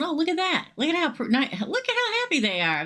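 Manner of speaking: high, sing-song voice of an outsider peering in; hammed-up dreamy sigh of the outsider; mock wistful onlooker. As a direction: gaze at happy people, half envious, half amused. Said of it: "looking in at everybody else going".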